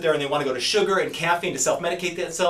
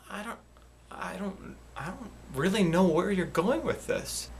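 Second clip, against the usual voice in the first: withdrawn murmur; 6 dB quieter than usual. Acted. Skit, withdrawn reply.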